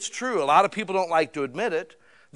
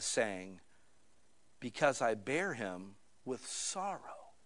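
solemn scripture reading; 4 semitones lower, 5 dB quieter than usual. Acted. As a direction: let each verse settle.

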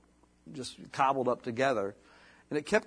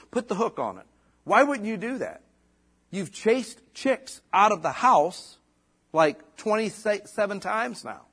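exasperated Bob; 5 semitones higher than usual. Retold or imitated. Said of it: "Bob spoke up and just said".

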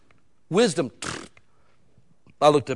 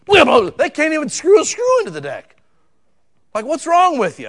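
much higher, loud exasperated yell; loud, high disbelieving shout; incredulous shout; 11 semitones higher, 9 dB louder than usual. Real, not acted. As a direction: blow up at the clumsy helper. Mocking the clumsy helper.

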